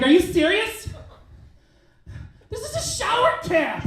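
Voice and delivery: very high, loud outraged shriek, imitated; hammed-up high-pitched outrage; pissed-off woman. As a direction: mimic a furious date in a high voice. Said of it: "then she gets pissed".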